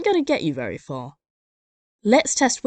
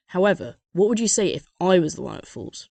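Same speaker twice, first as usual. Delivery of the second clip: normal talking voice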